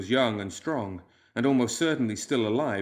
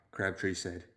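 ordinary delivery, nothing marked

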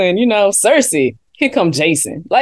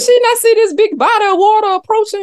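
high, fast incredulous quote; incredulous disbelief; 9 semitones higher, faster than usual. Retold or imitated. Viewer reacting to the river scene, incredulous. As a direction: gasp at the obvious danger. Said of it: "I was like".

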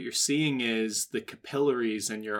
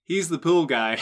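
usual speaking voice

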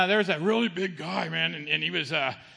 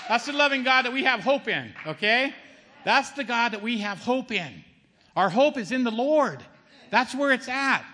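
raised, fervent preaching voice, louder and higher; 4 semitones higher, 3 dB louder than usual. Acted. Testifying to the congregation about God's love, fervent preaching. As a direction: proclaim it to the whole room.

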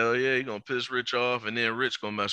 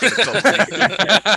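drawn-out mock hype; 10 semitones higher, 12 dB louder, slower than usual. Hyping Baker joke.

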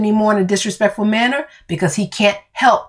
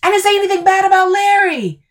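high, fast protesting yell; exasperated husband yelling; yelled denial; 13 semitones higher, faster than usual. Acted. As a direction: shout the denial back. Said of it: "Phil yells".

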